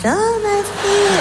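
high, slow doting mum; mimicking proud mother. Son mocks mother praising him on a step, high and slow. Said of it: "elle m'applaudit dès que je monte sur une marche".

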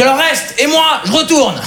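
high-pitched imitation of the commenter; mock outburst. Commenter changing his mind on hearing "Swiss", high mock outburst. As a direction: exclaim it high, drawing out the end. Commenter's reversed reply acted out.

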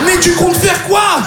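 mock boss voice. Boss asking, voiced high.

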